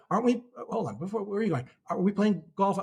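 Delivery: mock disbelief, hold on, where are you going; lightly acted trader balking at the order; incredulous pushback. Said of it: "he said".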